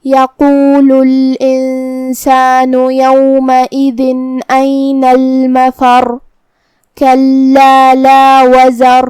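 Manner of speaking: slow tajweed recitation with long-held vowels; Quran recitation. Shown as a demonstration. Teacher opens the lesson's verses, slow Quran recitation. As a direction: recite slowly and evenly, drawing out the syllables.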